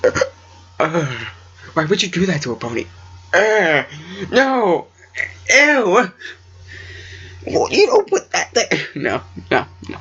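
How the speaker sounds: high, squealing disgust; hammed-up grossed-out Luna; disgusted princess. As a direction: recoil with a high-pitched ew. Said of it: "she's like".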